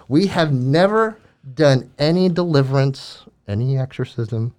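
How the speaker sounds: ordinary talk, nothing marked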